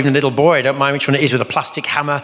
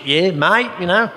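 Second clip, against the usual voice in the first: hammed-up Australian "mate", higher; Aussie mate; 6 semitones higher, much slower than usual. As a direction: play a broad Australian bloke. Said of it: "Good old Aussie mateship".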